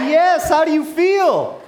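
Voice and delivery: high, loud, slow shout to a crowd; drawn-out triumphant crowd call; elated shout. Real, not acted. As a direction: holler it over the cheering. Speaker shouting to cheering crowd.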